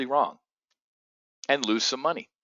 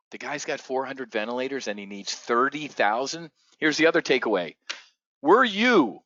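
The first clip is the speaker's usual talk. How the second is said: ordinary talk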